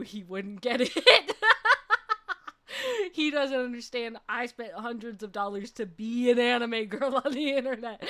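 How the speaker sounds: talking through giggles; amused, laughing disbelief; real laughter. Real, not acted. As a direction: crack up while telling it. Cracks up at her own story.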